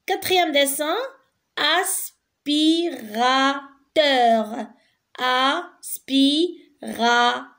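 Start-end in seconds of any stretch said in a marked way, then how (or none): 1.6-7.5 aspirateur very slowly as a... spi... ra... teur; four long, separate beats in aspirateur; syllable by syllable. Shown as a demonstration. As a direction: break aspirateur into drawn-out syllables. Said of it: "Quatre syllabes".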